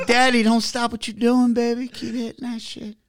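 high-pitched put-on pleading voice; mock sultry translation. Explaining the Spanish phrase to friend, high mock voice.

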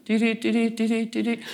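high, steady dee-dee signal tones; signal beeps. Shown as a demonstration. Speaker mimics a broadcast signal with steady high beeps. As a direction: imitate a beeping radio signal. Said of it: "strengthens our signal".

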